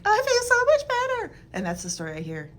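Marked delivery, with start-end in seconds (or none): 0.0-1.3 much higher and louder, relieved and happy; patient raving about feeling better; elated patient. Retold or imitated. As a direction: burst out with relief like a cured patient. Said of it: "she'll be like".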